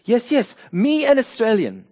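high, loud, eager mock foreigner; hammed-up tourist insisting in broken English; foreign gentleman. Foreigner tries the citizens' queue at the airport, hammed-up insistence. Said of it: "this foreign gentleman rolls up and goes".

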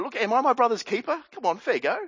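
high, quick indignant challenge; having a go. Retold or imitated. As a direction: push back as if unfairly accused. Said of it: "has a go at God".

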